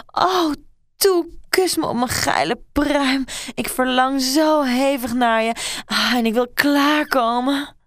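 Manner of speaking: high, loud pleading moans; moaning plea. A narrator's reading. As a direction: beg her, crying out with lust. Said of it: "gilde en steunde Mary". (Mary smeekt Dorothy hoog en luid kreunend)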